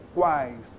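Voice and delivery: "quais" as a single quiet glide; single pulse. Shown as a demonstration. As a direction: say "quais" in one falling push, vowels joined. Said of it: "só uma pulsão aqui".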